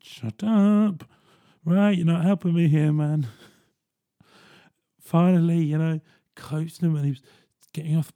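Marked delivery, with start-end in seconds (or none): 0.0-3.3 high, loud, drawn-out "shut up"; exasperated inner outburst. Retold or imitated. Go-between silently begging the old man to stop, exasperated. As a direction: snap in frustration at someone undermining your help. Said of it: "I kept in my head just like".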